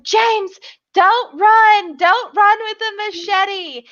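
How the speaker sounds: high-pitched, urgent warning to a running teammate; lightly hammed-up anxious warning, high; mock panicked warning. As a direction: yelp it like a worried partner. [name] warning James while running.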